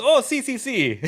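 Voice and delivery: high, loud laughing mock Italian; excited Italian taxi driver. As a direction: squeal si si like a delighted driver. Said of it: "And he goes".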